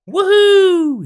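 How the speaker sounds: big high whoop of thrill; joyful shout. Acted. Bruno whooping down at the dangling friend, loud shout. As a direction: whoop it out at the top of the lungs. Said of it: "he shouted".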